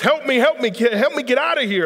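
high, loud, frantic cry for help; screaming for help. Retold or imitated. Trapped soldier to a passing psychiatrist, screaming for help. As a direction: shout it out, urgent and high. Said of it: "he screams out".

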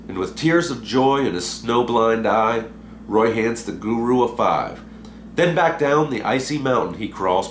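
ordinary delivery, nothing marked

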